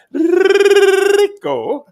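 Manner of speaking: long high sung-out "Puerto Rico", slow and loud; drawn-out call. Acted. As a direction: stretch "Puerto Rico" like a game-show host. Sing-song stats announcer call.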